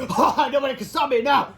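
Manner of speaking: high, loud triumphant laugh and boast; hammed-up evil glee; gloating villain. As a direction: crow over the victory.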